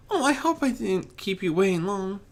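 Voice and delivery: high, anxious little plea; a shy pony afraid she is late; timid worried pony. Acted. Fluttershy worried.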